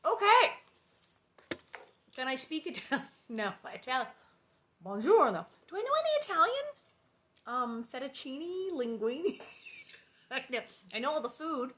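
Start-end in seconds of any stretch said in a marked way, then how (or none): 5.7-6.6 squeaky self-quiz, higher; playful high-pitched wondering; high playful question. Real, not acted. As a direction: pitch way up, teasing yourself.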